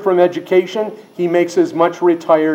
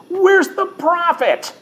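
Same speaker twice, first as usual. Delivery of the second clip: very high, drawn-out rhetorical question; high challenging cry; 13 semitones higher, slower than usual. Acted. Cries out the verse's challenging question.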